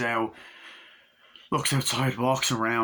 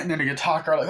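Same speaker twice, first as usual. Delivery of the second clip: normal talking voice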